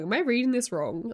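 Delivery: ordinary talk, nothing marked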